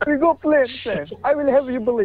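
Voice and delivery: high-pitched mock-Arabic accent, a little Russian; put-on foreign accent. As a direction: keep up the joke Arabic accent. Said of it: "You do that accent well".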